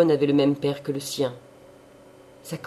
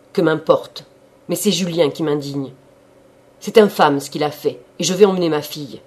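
much louder, fast angry outburst; agitated anger; 9 dB louder than usual. A narrator's reading. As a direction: explode with fury. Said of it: "tremblait d'énervement".

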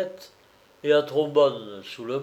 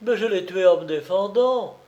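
higher, louder protest; defensive cry; 6 semitones higher, 4 dB louder than usual. A narrator's reading. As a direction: plead your innocence loudly. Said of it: "cria-t-il".

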